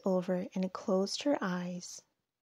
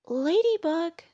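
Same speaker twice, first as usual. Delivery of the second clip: high, louder storybook call; 10 semitones higher, 7 dB louder than usual. A narrator's reading. Woodchuck calls Ladybug high in the tree, calling out. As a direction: call up to someone far above. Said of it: "called Woodchuck".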